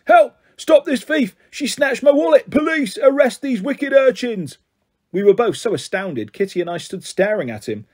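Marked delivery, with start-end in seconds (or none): 0.1-4.6 high reedy yell for the police; reedy old man shouting. A narrator's reading. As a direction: shriek for help like a wronged old beggar. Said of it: "shouting in a reedy voice".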